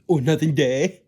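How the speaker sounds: very loud, high silly blurting; over-the-top loud silly answer. Acted. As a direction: blare it out in a goofy high voice. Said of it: "it's silly".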